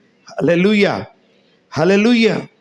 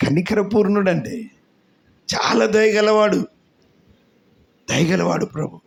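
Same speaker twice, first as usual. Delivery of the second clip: usual speaking voice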